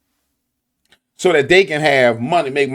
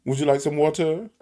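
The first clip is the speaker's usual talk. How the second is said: softer, polite standard "water" with clear r; proper polite water; 6 dB quieter than usual. Shown as a demonstration. Proving he can say standard "water", softer and polite. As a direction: ask it like a prim waiter. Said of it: "of course I know how to say water".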